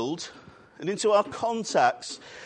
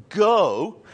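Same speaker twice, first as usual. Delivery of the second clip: "go" hit loud as the wrong emphasis; stress on GO; 7 dB louder than usual. Shown as a demonstration. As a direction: punch "go" to show what it isn't. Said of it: "the emphasis in the Greek isn't on".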